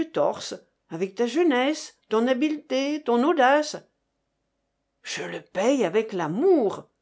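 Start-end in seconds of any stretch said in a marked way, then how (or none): none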